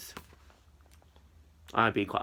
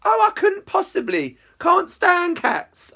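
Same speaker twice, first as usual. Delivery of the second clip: very high, drawn-out posh English voice; mock-posh retort; 7 dB louder, much slower than usual. A narrator's reading. Narrator joking back to Izzy, mock-posh retort. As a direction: play the hammed-up posh English lady. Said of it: "I retort".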